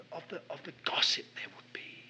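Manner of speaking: whispered worry about gossip; whispering, almost voiceless. Retold or imitated. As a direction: hush it under the breath. Continuing the whispered thought.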